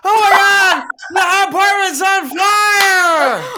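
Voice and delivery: high screaming panic; panicked shout. Acted. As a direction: yell that the apartment's burning. Panicked over the fire.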